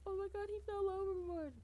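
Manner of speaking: high fake-shocked voice; high hammed-up fake dismay; mock innocent widow. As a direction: pretend innocent alarm. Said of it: "be like".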